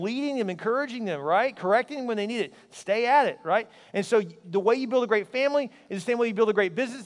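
usual speaking voice

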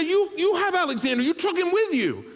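high, loud, half-laughing insistence; a husband telling his wife she has the baby; flustered protest. Retold or imitated. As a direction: protest in rising panic.

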